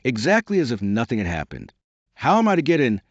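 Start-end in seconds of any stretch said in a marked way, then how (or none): 2.1-3.0 louder, higher insistent question. Acted. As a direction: say it louder, losing patience. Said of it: "in a louder tone".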